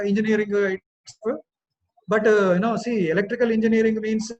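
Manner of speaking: loud, heavy Indian English lecture voice, retroflex t and d; exaggerated Indian English classroom delivery; Indian English accent. Shown as a demonstration. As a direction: play a typical Indian engineering lecturer. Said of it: "which I call as Indian English".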